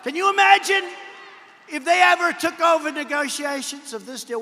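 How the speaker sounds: loud, high, emphatic Can you imagine; rally shout. Real, not acted. Speaker to rally crowd, loud and high emphasis. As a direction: belt it to the crowd, stressing imagine. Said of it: "repeated rhetorical line, first take".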